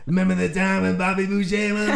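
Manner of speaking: high, garbled Cajun caricature; Southern Cajun accent. Shown as a demonstration. Friend mocking how the South talks, high Cajun accent. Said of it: "people in the South".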